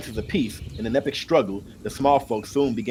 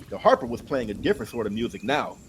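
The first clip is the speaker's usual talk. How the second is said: plain reading voice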